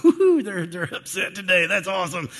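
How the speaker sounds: hammed-up gleeful gloat; mock glee. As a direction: ham up a delighted parent you'd never be. Mock gleeful parent, ironic.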